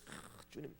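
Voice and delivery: breathy whispered plea to the Lord; a soft unvoiced cry of 아, 주님; whispered sigh of prayer.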